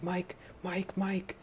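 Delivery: high, urgent calling of Mike's name; frantic call. Retold or imitated. Chris panics at the sheriff's door, frantic high call. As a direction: Chris bursts in shouting for Mike. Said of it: "He's like".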